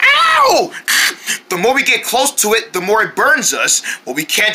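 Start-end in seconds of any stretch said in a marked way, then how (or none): 0.0-0.7 high drawn yelp of pain; sudden high ow; pained yell. Acted. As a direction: cry out as if burnt. Burnt again.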